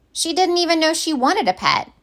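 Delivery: plain reading voice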